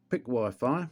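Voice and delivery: ordinary talk, nothing marked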